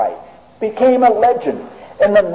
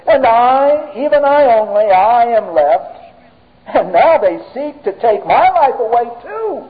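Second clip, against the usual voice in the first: acted self-pity; 6 semitones higher than usual. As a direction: play the prophet feeling sorry for himself. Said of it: "He is full of self-pity".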